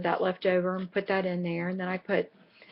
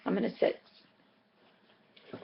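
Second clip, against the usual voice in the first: ordinary talk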